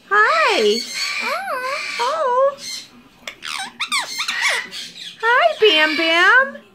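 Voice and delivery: high, slow cooing hello; pet talk greeting. Real, not acted. Owner saying hi to Bam Bam, high cooing pet voice. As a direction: greet the little bird sweetly.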